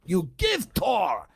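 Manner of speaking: slow, demanding mock Russian accent; insistent broken-English Russian accent. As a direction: imitate a pushy Russian tourist, Russian accent. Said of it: "She goes".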